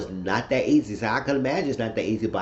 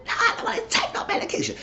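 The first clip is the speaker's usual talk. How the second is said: much higher, half-laughing whine of refusal; mock shrill refusal; faster than usual. Tanya pushes back at Bennett over her pills, high and laughing. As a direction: play the stubborn wife who won't take her pills, squeaky and giggly.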